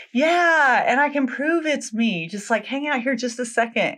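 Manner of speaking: high-pitched, keen heroine; eager mock confirmation. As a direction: imitate her jumping at the chance to prove herself. Girl eagerly claims the shoe.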